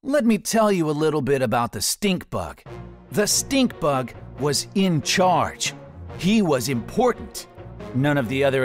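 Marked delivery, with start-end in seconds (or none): none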